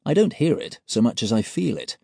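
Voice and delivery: usual speaking voice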